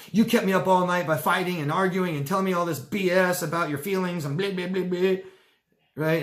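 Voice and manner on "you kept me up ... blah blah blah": higher, louder put-upon complaining; whiny victim. Acted. Victim griping to the hero, whiny and put-upon. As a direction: whine like the wronged one. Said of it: "the victim says to the hero".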